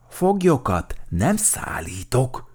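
distrustful, deliberate character voice; suspicious. A narrator's reading. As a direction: draw it out with suspicion. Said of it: "mondta gyanakodva Vatkan Valdemár".

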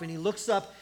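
normal talking voice

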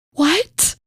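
high, stunned one-word reaction; taken aback. Acted. Mom hearing she loves too hard, taken aback. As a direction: jump up in pitch, acting stunned.

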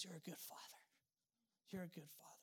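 a moved father quietly reassuring his son; tender fatherly words. Retold or imitated. Father hugs him, speaks softly.

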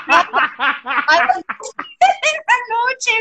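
high, drawn-out words through laughter; entre risas. Real, not acted. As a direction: squeal it out while cracking up. Laughing at being thrown out at midnight. (voz aguda ahogada de risa)

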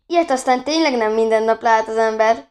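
plain reading voice

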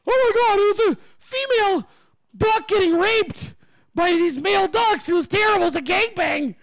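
mock horror. Leah reporting the duck attack to friends, mock horror. Retelling a woman's horrified report.

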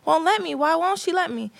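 put-on high-pitched kid's grievance; imitated childish complaint. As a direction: plead the case like a thwarted child. Child complains to dad by phone.

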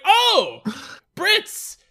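mock-posh British, high and loud; poor British accent. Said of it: "as my poor British accent kicked in".

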